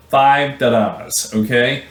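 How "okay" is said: normal talking voice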